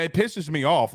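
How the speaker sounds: ordinary talk, nothing marked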